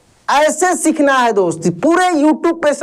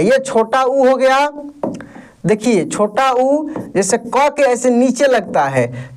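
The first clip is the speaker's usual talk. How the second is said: ordinary talk, nothing marked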